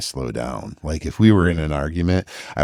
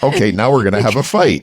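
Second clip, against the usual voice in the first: playfully picking a fight, louder and quicker; mock-combative, raised pitch; mock agitated; 7 semitones higher, 6 dB louder, faster than usual. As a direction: announce a pretend fight, fast and loud. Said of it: "I'm getting agitated".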